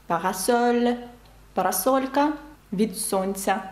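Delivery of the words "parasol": "Parasol" stressed on -sol; final-syllable stress. Shown as a demonstration. Teacher giving another French example, stress on -sol. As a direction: land on the last syllable of "Parasol". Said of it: "на останній склад падає наголос".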